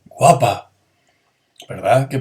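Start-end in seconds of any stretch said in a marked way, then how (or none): 0.0-0.7 loud admiring exclamation; recalled piropo reading; flirtatious compliment. Shown as a demonstration. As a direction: call out a compliment. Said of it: "como en".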